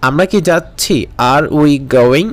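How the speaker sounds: usual speaking voice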